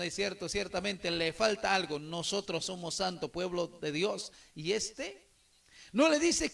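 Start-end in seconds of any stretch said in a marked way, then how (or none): none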